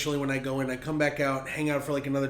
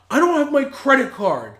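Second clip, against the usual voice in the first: put-on higher, louder drunk protest; drunk friend whining; 10 semitones higher, 9 dB louder than usual. Drunk friend protesting to his sister, high and loud. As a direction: whine it like a drunk.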